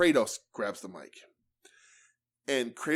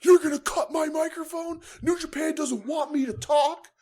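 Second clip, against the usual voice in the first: high squeaky mock voice of an indignant wrestler; shrill parody of a whiny promo, much higher; 3 dB louder than usual. Mocking a wrestler whining about his dead mic, high squeaky voice. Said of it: "So he goes".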